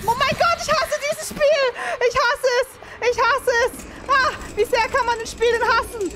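high, loud furious yelling; raging gamer. Real, not acted. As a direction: yell your hatred of the game. Furious at the game.

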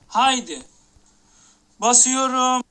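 loud, hyped-up announcement; excited shout. Real, not acted. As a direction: yell it as the moment arrives. Hyping the case opening. (coşkuyla bağırarak)

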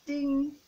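bright bell ding. Shown as a demonstration. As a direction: ring it out like a bell. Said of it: "by saying ding".

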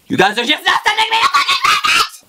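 high, fast outraged yell; furious shout. Retold or imitated. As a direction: scream it in disbelief at them. Outraged at the tow company.

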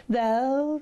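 put-on high-pitched young frog; verliefde jonge kikker. Young frog answering old frog. (hoge stem van de verliefde jonge kikker)

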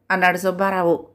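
ordinary delivery, nothing marked

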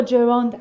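«جراند» said as "je-ROND"; common mispronunciation. Shown as a demonstration. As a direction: say «جراند» with an open o in the last syllable. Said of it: "که تلفظ غلطیه". (تلفظ غلط و رایج «جراند»)

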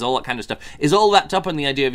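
normal talking voice

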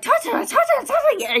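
high, mock yelling of a name; retold yelling. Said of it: "yelling at him".

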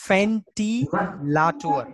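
"ventilator" broken into slow syllables, fen-tee-la-too-er; drawn-out teaching take of "ventilator"; German V as F. Shown as a demonstration. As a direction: say ventilator slowly with an F. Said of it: "So V sound is F sound".

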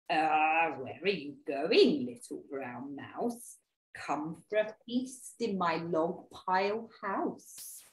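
slow, coaxing snake; storybook snake. Acted. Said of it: "and a snake saw the mouse".

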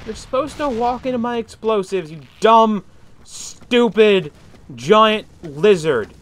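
loud, high-pitched rant; exasperated scolding. Pretend. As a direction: scold the enemy, hammering each insult. Scolding an enemy in game.

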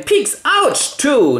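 squeaked stab, then high triumph and yelp; mock duel hit. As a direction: act out both fencers, high-pitched. Second acted duel hit.

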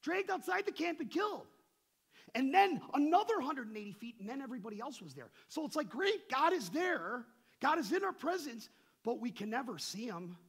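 loud, high-pitched sermon delivery; impassioned preaching. Real, not acted. Preacher explains God's unreachable presence to the congregation, impassioned. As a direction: preach it out at full force.

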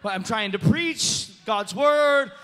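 loud, drawn-out mock protest; exasperated. Preacher argues with his own strange vision, exasperated. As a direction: belt it out slowly in comic frustration.

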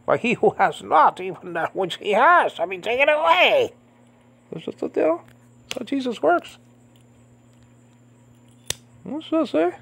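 high, tearful real voice; choked up. Real, not acted. Preacher quoting scripture to listeners, choked up. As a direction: push the verse out through tears, very high. Preacher moved to tears while teaching.

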